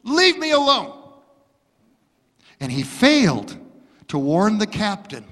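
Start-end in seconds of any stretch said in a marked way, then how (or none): 0.0-0.9 high, loud brush-off; angry telegraph operator. Retold or imitated. Telegraph operator snapping at the warning, loud and high. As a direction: shout him off, too busy. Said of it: "He responded back with this".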